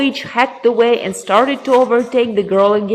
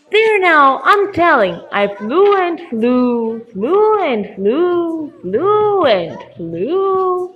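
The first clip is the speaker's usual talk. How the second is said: high, drawn-out singsong; rambling raven; 5 semitones higher, slower than usual. Acted. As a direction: stretch each repeated word in a long high swoop. Raven drags out its story.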